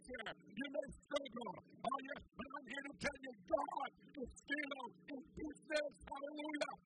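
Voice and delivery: loud, high preaching at full force; fiery preaching. Acted. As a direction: preach it out to the congregation.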